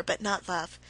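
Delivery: plain reading voice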